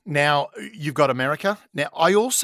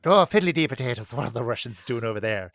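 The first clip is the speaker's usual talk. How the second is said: hammed-up Irish surprise at the Russians off the coast; a light Irish accent, exclaiming then settling. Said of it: "we have hit the trifecta of accents".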